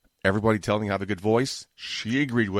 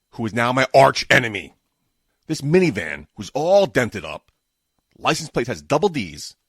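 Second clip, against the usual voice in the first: loud, high exasperated rant; angry rant; 5 semitones higher, 5 dB louder than usual. Real, not acted. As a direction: vent it loud and fed up. Ranting about slow minivan.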